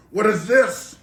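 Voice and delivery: loud, high mock German shopkeeper; hammed-up German accent, very loud. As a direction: imitate a German shouting in disbelief. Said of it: "in his German accent voice".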